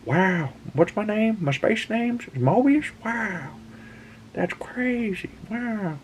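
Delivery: very high, wide-eyed wonder; amazed goofball. Retold or imitated. Don thrilled to hear his space name, very high awed. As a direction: marvel like a kid at a new name, very high. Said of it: "He's like".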